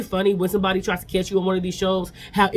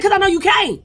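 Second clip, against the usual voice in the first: much higher, louder angry retort; going off; 7 dB louder than usual. Retold or imitated. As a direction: snap back high and loud, fed up. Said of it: "she gotta go off on him".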